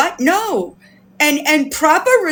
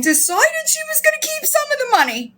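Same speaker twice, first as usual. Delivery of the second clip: fast, high-pitched disbelief; scandalized, quick and high; incredulous indignation; 12 semitones higher, faster than usual. Real, not acted. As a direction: shoot up high and rush it, appalled. Indignant summary of the fraud.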